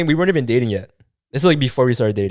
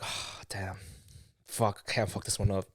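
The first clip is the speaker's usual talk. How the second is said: much softer, low, under the breath; muttering to himself; 4 semitones lower, 14 dB quieter, slower than usual. Retold or imitated. Alone after she hangs up, muttering softly to himself. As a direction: mutter it as if nobody should hear.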